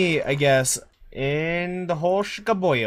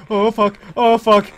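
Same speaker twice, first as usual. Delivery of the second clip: panicking gamer; 7 semitones higher, 10 dB louder than usual. Real, not acted. Monster nearby, rising panic.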